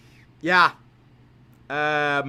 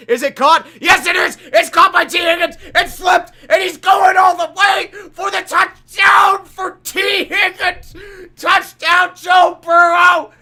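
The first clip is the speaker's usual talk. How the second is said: higher, louder shouted touchdown call, slowing on the big words; 6 semitones higher, 4 dB louder than usual. Acted. Calling the Bengals touchdown for chat, shouting in excitement. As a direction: yell it like the stadium just erupted.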